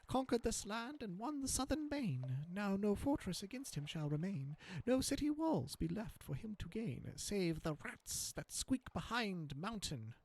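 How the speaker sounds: high, stately declaiming rodent lady; grand seneschal voice. Acted. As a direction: recite it like a court herald.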